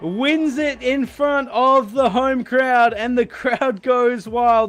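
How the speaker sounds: rising, shouting the race finish; excited commentary. Real, not acted. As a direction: call the finish at full roar. Race finish, commentator calls winner.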